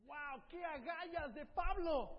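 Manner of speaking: asombro. Real, not acted. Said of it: "predicador asombrado".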